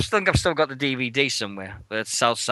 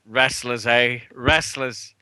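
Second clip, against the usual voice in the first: knowing aside; 5 semitones lower, much slower than usual. Retold or imitated. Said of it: "he went".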